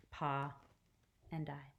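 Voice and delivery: ordinary delivery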